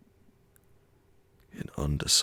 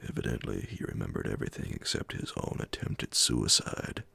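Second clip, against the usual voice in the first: ordinary delivery, nothing marked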